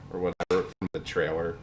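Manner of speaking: ordinary talk, nothing marked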